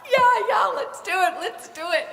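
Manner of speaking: eager high yes; a grandson's high eager yes; excited kid. Retold or imitated. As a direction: jump at the idea like an excited kid. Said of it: "And he's like".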